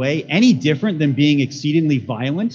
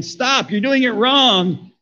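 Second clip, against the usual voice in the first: raised-pitch, drawn-out objection; indignant protest; 10 semitones higher, 3 dB louder, slower than usual. Acted. Clay pot talking back to its potter, indignant. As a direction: protest like an offended creation.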